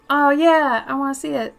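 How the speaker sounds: loud eager exclamation; excited. Real, not acted. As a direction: burst out with delight. Excited about thunderstorms.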